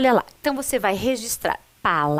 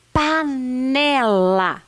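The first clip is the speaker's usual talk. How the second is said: very slow "panela", each syllable stretched out; drawn-out syllables; 3 dB louder, much slower than usual. Shown as a demonstration. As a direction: stretch panela out for young learners. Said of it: "Quantas sílabas possui panela?". (sílabas bem esticadas)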